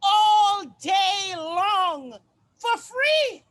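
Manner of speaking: slow, loud wailing shout; crying shout. Acted. Performer wailing her grievance at the audience, slow and loud. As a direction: drag out the outrage in a wailing yell.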